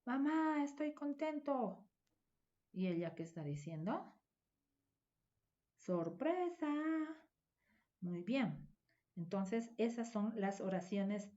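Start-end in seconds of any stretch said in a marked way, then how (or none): 0.0-1.9 put-on a small boy telling his mom he is happy; happy child. Shown as a demonstration. Boy tells his mother he is happy, high child voice. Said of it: "¿Y el niño qué estará diciendo?". 5.8-6.7 high "Sorpresa" falling off; spring the surprise gently; cheerful surprise. Shown as a demonstration. Woman surprises someone, high excited call.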